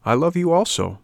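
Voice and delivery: affectionate fairy. Acted.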